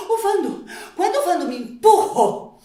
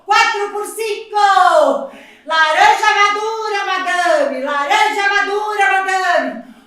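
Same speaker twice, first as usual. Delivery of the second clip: loud sing-song fruit vendor cry; rhyming market barker; 9 dB louder than usual. Acted. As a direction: call out your fruit to the whole market. Street vendor crying wares.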